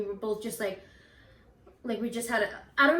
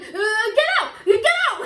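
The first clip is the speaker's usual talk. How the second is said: high, louder, shaky command; scared command; 11 semitones higher, 9 dB louder than usual. Retold or imitated. Telling friends how they ordered the ghost away, scared high command. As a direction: order it out while terrified. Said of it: "we're so scared".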